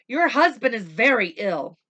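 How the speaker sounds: loud, high, urgent doctor; doctor's warning. Acted. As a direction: tell her gravely but firmly. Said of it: "he said".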